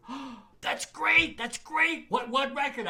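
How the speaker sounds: hammed-up excited owner voice; thrilled club owner. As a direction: squeal it like the best news of his life. Club owner hears the stunt idea.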